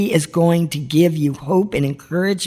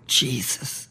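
zachte, ingetogen uitroep van de naam; cry out softly; 2 semitones lower, 8 dB quieter than usual. Shown as a demonstration. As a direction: fluister de naam bijna. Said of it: "cry out".

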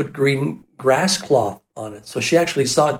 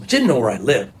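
ordinary talk

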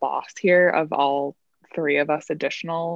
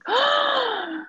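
dramatic gasp. Acted. Mock gasp before quote.

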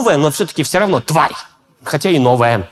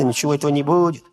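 ordinary talk, nothing marked

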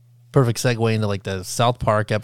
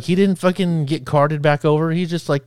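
ordinary delivery

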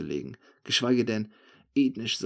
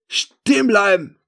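high, drawn-out shout; 10 semitones higher, 12 dB louder, slower than usual. A narrator's reading. Narrator blocking the fleeing thief, shouting. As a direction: yell a slow, loud halt command. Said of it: "schreie ich".

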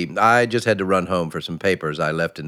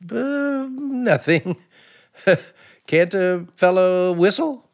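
flustered cover-up; 10 semitones higher than usual. Real, not acted. Caught snooping, covering up.

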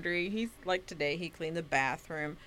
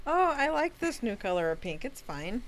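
higher, sugary start that drops off at It's fine; passive aggressive; 5 semitones higher than usual. Pretend. Friend showing what she'd say about pink laundry, passive aggressive. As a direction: fake praise with a bite underneath. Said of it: "Real passive aggressive".

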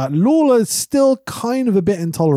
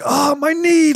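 mock pained wrestler; 9 semitones higher, slower than usual. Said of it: "You're like".

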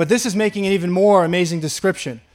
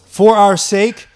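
For our sake, louder and slow; rising proclamation; loud emphatic; 7 dB louder, slower than usual. Acted. Preacher climaxes repeated phrase.

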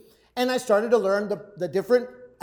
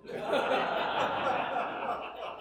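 soft laugh; 4 semitones higher, 7 dB quieter than usual. Real, not acted. Laughing at own story.

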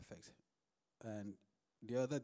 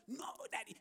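mock child protest; 10 semitones higher, faster than usual. Daughter's protest quoted again.

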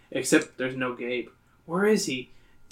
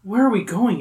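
anxious; 7 semitones higher, 4 dB louder than usual. Acted. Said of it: "you ask anxiously".

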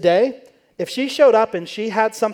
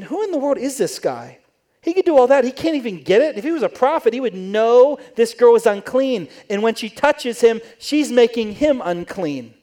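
higher, louder indignant scorn; scornful Pharisee; 5 semitones higher, 4 dB louder than usual. Retold or imitated. Pharisee judging Jesus and the sinful woman, loud high scorn. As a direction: sneer at the guest who lets her touch him.

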